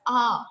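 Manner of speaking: "A" said as French ah; open ah sound. Shown as a demonstration. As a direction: pronounce "A" as an open ah. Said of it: "we will pronounce it as".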